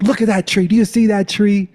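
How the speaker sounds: high, loud, urgent order; insistent command. Retold or imitated. Boyfriend ordering his brothers to look, insistent shout. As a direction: shout it to make them look.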